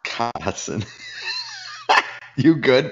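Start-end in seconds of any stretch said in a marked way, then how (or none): 0.0-0.8 "Cotton" as a mock-German "kah-tsen"; teasing "Cotton" with ts for the t; mock German pronunciation. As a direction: drag out "Cotton" with a hard ts, mimicking. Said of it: "That's how you say cat in German".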